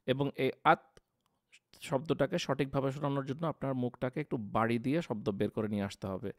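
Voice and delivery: ordinary talk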